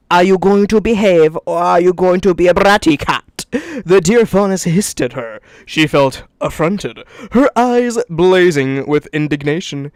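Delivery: reading a story aloud; story narration. Acted. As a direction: read it like narrating a novel. Reads the fanfic aloud.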